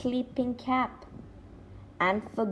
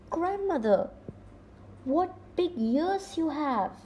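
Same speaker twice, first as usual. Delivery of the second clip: put-on higher, wondering girl's voice; a child edging closer, curious; little girl; 7 semitones higher, 3 dB quieter than usual. Girl edges toward the bed, higher and wondering. Girl questions disguised wolf.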